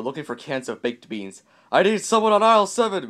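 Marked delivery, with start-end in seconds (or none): 1.7-3.1 loud, raised-pitch call for help; shout. A narrator's reading. Shopper calling for a clerk in a superstore, shouting. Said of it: "Mister Yell shouted".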